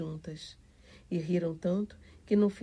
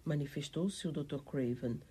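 usual speaking voice

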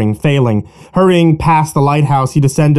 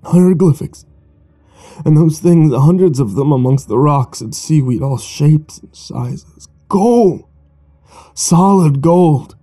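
feverish tale; 2 semitones higher than usual. Acted. Joe's feverish tale of gold.

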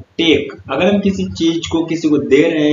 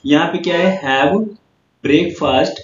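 normal talking voice

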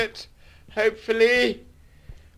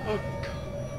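whispered dread; 10 dB quieter than usual. Real, not acted. Monster appears.

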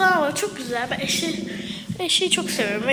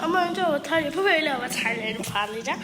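usual speaking voice

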